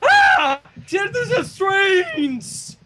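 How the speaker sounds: high, loud, slow shrieking cry; hammed-up panic. Pony panicking at the horror book, high slow shriek.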